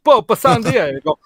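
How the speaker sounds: papal announcement. Pretend. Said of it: "Sounds like a, like the new Pope".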